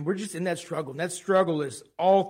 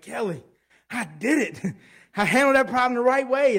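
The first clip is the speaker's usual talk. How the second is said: proud to wife; 6 semitones higher, 4 dB louder than usual. Retold or imitated. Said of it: "I'm like".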